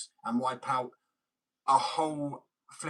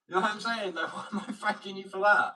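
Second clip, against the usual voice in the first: laughing; 8 semitones higher, 3 dB louder than usual. Real, not acted. Laughing incredulous rant.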